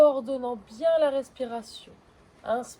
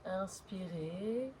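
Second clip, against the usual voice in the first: fading breath cues; 2 semitones lower, 6 dB quieter, slower than usual. Acted.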